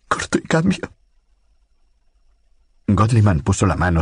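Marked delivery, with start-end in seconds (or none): 0.1-0.9 much higher, a desperate rising plea; frightened pleading sign-off. A narrator's reading. Lucy signs off to Godliman, desperate and frightened. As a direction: end the message like a prayer for help.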